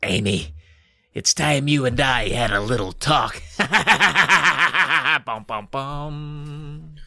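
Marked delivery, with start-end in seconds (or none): 0.0-3.4 raspy dummy. Acted. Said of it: "and he rasps". 3.5-5.2 evil dummy laugh. Acted. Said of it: "and he rasps".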